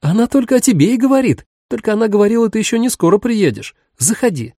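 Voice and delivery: high ringing little voice; tiny tinkling snowflake sprite. A narrator's reading. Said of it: "звенящий голосок".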